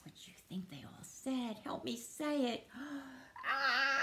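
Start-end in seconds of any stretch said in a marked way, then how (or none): 3.5-4.0 sharp gasp, then long high "ah"; gasp and scream. Acted. Reader screams for all the friends, gasp then long cry. Invites children to scream along.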